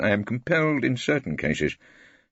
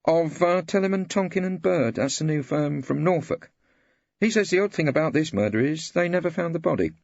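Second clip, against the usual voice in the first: a lightly voiced clerk, higher and pleased; eager clerk; 11 semitones higher than usual. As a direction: bring good news to the barrister. Clerk Henry explaining case.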